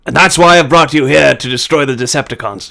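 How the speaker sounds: lightly voiced wise old Brit; a hint of a lofty British mentor; mild Hopkins impression; Anthony Hopkins. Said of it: "Anthony Hopkins".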